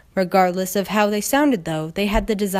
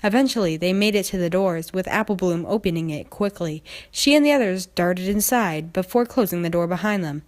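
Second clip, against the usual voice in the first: usual speaking voice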